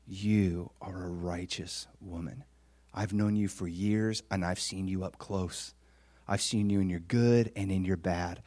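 low, soft, earnest praise; hushed, heartfelt words of affirmation; sincere affirmation. Retold or imitated. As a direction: speak it quietly, as someone who knows them well. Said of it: "looks you in the eye and says".

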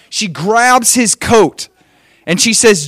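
ordinary talk, nothing marked

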